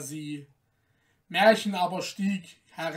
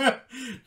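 mocking guffaw; 5 semitones higher than usual. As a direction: laugh her off the doorstep. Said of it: "lachten aus vollem Halse".

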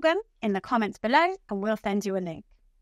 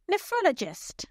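"Nephrologist" with ROL stressed, said higher; stress on third syllable; 6 semitones higher than usual. Shown as a demonstration. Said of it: "The third syllable is stressed".

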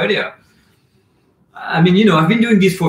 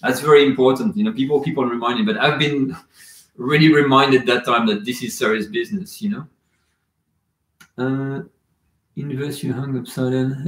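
usual speaking voice

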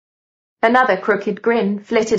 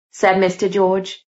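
ordinary delivery

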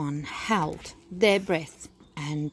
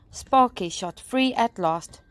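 ordinary delivery

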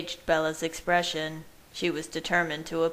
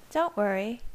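higher, light voice for her; Bella's voice; 5 semitones higher than usual. A narrator's reading. Reassuring the narrator who apologised, in Bella's voice.

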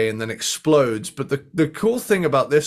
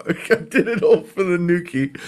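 words broken by laughter; laughing; 5 semitones higher than usual. Real, not acted. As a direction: laugh through the lyric. Cracking up at the pun.

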